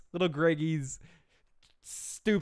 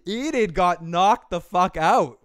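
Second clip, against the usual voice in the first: ordinary delivery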